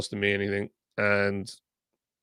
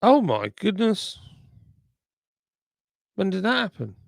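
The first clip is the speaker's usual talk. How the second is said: surprised; 8 semitones higher than usual. Real, not acted. Sees new subscriber count live.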